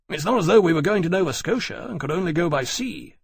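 heated objection in dialogue; argumentative retort. A narrator's reading. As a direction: push back, exasperated. Granby argues back at Riley.

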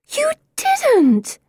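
very high, scandalized squeal; horrified gasp. A narrator's reading. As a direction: gasp it out, appalled and disbelieving. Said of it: "Pippa gasps in horror".

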